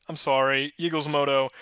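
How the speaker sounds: ordinary talk, nothing marked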